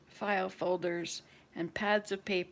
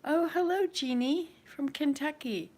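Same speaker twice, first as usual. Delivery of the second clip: delighted greeting; 7 semitones higher, 4 dB louder than usual. Retold or imitated. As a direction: greet an old friend warmly surprised. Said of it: "you say".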